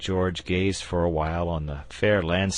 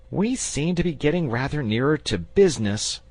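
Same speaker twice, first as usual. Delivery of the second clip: put-on boy's voice; 10 semitones higher, 5 dB louder than usual. A narrator's reading. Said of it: "put in the boy".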